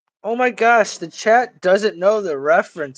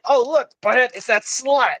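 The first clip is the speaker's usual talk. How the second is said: high cartoon teen voice; pitched-up goofy movie line; Beavis; 8 semitones higher than usual. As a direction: imitate an excited dopey cartoon kid. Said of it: "he's like".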